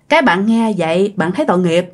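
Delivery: usual speaking voice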